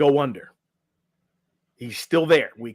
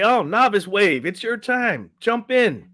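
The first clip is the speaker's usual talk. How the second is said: higher, louder official calling the wave in; 7 semitones higher, 4 dB louder than usual. Retold or imitated. Race official calls the novice wave to jump in. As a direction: sing out the start call to the swimmers.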